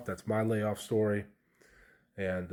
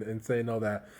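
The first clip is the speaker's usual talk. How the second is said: ordinary delivery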